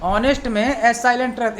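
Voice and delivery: normal talking voice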